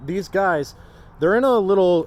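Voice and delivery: talking loud over the wind. Real, not acted. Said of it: "you can hear me over the wind".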